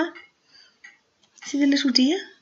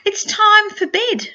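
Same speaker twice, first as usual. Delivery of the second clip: sing-song refrain, pitched up; bedtime story refrain; 9 semitones higher than usual. A narrator's reading. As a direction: lift the refrain like a lullaby for a toddler. Reads the refrain.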